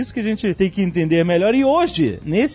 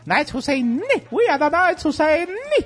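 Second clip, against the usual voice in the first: high, booming knight chant; Monty Python knights; 10 semitones higher than usual. Retold or imitated. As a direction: boom it out like the Knights who say Ni. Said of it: "palavra inventada pro filme".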